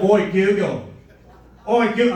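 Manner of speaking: mock Australian roommate; lightly put-on Australian accent, calling out. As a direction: imitate an Australian trying a voice command. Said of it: "he's Australian".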